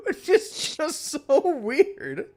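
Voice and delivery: high, loud, slow exclaim; drawn-out disbelief. Real, not acted. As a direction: stretch it out in disbelief. Exaggerated disbelief.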